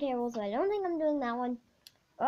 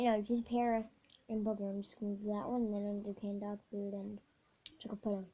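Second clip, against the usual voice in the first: ordinary talk, nothing marked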